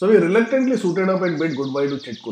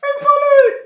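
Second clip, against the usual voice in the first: very high, loud falsetto wail; mock scream; 8 dB louder than usual. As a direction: squeal it as if sliding away. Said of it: "he was screaming".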